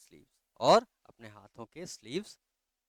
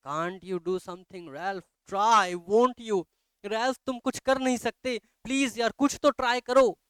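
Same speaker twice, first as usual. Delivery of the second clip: higher and louder, urgent pleading; desperate begging; 9 semitones higher, 7 dB louder, slower than usual. Acted. Annabel begging Ralph to save the child, desperate. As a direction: beg him as her last hope.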